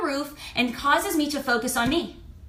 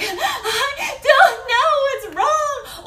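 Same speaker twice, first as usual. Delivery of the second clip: high, slow laughing fake sob; mock crying; 12 semitones higher, 5 dB louder, much slower than usual. Imitating a stressed person crying to herself. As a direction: whimper it like a teary wreck, half laughing. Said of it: "whether you're crying like".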